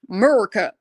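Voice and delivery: a quick mock American country accent; bad American country accent. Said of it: "a country accent".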